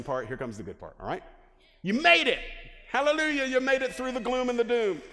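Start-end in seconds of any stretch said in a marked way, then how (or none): 1.8-5.0 high, loud hallelujah shout; jubilant cheer. Pretend. Celebrates getting through depressing part.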